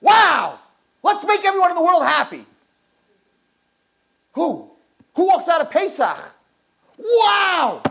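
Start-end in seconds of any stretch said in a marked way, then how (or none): 0.0-2.4 high, exclaimed burst of joy; hammed-up overflowing happiness; mock elation. Someone walks out of happy Tehillim, exaggerated elation. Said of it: "Tehillim that's about happiness". 7.0-7.8 high, drawn-out exclamation; hammed-up amazed delight; mock awe. Someone walks out of Pesach, exaggerated amazement. Imagined elation leaving Pesach.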